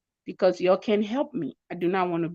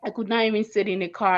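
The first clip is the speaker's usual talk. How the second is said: normal talking voice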